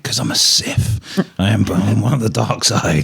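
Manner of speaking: joking dark-lord voice; mock Sith. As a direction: play a hammed-up villain to a curious kid. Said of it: "I'd be like".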